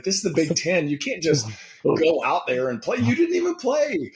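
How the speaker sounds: dad's protest climbing to a high pitch; incredulous dad. Retold or imitated. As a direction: imitate a dad objecting, voice rising sharply. Acting out dad's incredulous objection.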